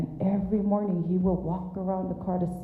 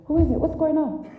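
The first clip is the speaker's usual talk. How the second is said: high, loud alarmed mother's voice; a startled mother calling out from the room; alarmed mom calling; 11 semitones higher, 5 dB louder than usual. Retold or imitated.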